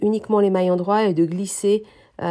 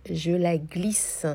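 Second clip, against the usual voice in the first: slowed-down demo; 3 semitones lower, 5 dB quieter, much slower than usual. Shown as a demonstration. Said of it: "j'ai fait un ralenti".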